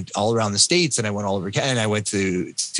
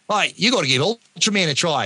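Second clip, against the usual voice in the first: put-on higher-pitched amateur Australian accent; 8 semitones higher than usual. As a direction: imitate an Australian friend egging someone on. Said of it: "I won't do the Australian accent anymore".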